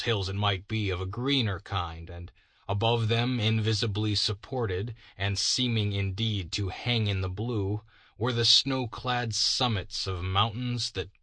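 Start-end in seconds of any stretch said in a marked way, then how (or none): none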